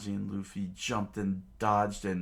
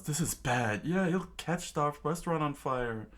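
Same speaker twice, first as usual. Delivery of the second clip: higher-pitched, mildly alarmed voice; worried crew; 7 semitones higher than usual. A narrator's reading. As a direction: fret about it in a raised pitch. Chef's crew worried about fire.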